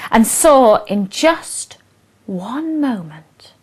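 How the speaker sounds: expressive read-aloud of a rhyming poem; storyteller reading verse to an audience; poem recitation. Acted. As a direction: recite it with relish, line by line.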